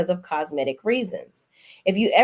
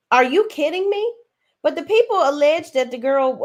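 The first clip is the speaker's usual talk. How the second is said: incredulous; 7 semitones higher, 3 dB louder than usual. Real, not acted. Outraged rhetorical question.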